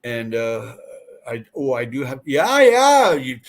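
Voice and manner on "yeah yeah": excited. Real, not acted. Excited agreement while laughing.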